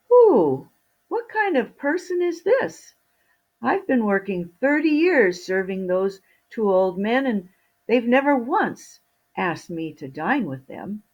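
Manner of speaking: high, indignant voice from nowhere; a put-upon servant finally speaking up; invisible servant. Acted. Invisible servant complains to Fido, high and indignant.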